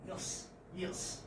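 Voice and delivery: "yes, yes" said quickly and softly. Shown as a demonstration. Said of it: "with a speed".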